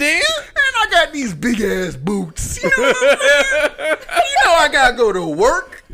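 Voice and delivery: high, loud, slow laughing mock Obama; Obama impression. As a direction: drag it out, cracking up as Obama. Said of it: "Let's hear your Obama impression".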